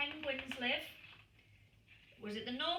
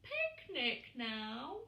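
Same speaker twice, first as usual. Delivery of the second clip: slow, high pleading chick voice; child asking again on a long trip; whiny little penguin; 5 semitones higher, slower than usual. A narrator's reading. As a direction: drag out the same old question. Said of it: "his usual question".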